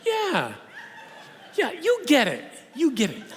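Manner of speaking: gushing approval from the heckler; a hint of the thrilled woman who finally feels understood; delighted woman. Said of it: "And the woman goes".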